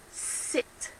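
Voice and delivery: "Sit" whispered with a short clipped i; whispered demo word. Shown as a demonstration. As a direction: breathe "Sit" softly, short vowel. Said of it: "to make eh".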